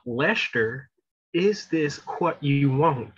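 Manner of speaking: slow, deliberate movie-villain impression; Billy Bob Thornton. Quoting a favorite Fargo scene to the co-host, slow impression. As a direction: draw out each word, putting on the character. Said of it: "Billy Bob Thornton was killing it".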